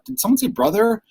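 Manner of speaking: did-I-hear-that reaction, imitated; mock double take. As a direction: act out a puzzled double take. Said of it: "he even kinda did a double take".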